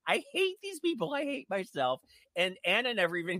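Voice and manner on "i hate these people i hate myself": much higher, squealing mock panic; mock self-horror. Retelling her own horrified realization as a high squeal. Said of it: "it was like this whole".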